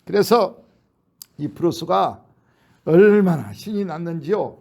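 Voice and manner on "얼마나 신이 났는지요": usual speaking voice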